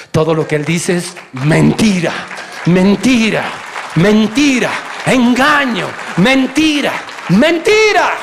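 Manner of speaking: preacher's rising cry against lies; building to a shout. Real, not acted. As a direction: hammer the word mentira harder every time.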